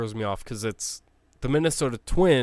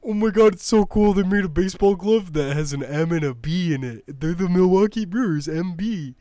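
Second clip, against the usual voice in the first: mocking an excited fan, louder; mock gushing fan; 5 semitones higher, 4 dB louder than usual. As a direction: gush like a fanboy, sarcastically. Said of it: "I don't understand the praise".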